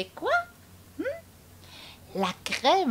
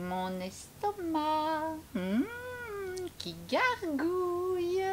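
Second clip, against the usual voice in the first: slow teasing; 7 semitones higher, 7 dB quieter, slower than usual. Acted.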